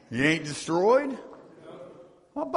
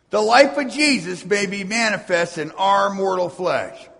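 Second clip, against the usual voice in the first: ordinary delivery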